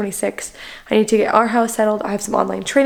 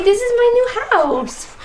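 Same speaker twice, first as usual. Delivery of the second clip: put-on high baby voice for the baby; speaking for the baby; 13 semitones higher than usual. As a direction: voice the baby's words, sing-song.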